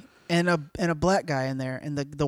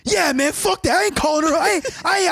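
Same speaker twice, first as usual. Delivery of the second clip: excited mock rapper; 9 semitones higher than usual. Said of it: "he goes".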